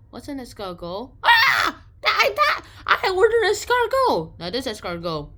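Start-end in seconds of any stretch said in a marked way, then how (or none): 1.2-4.4 high, loud alarmed cry; shocked realization. Real, not acted. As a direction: yelp in horror at what you ate. Realizing he ate snails.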